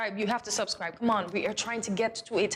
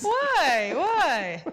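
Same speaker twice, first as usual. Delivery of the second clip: laughing; 6 semitones higher, 4 dB louder than usual. Real, not acted. Cohost laughing.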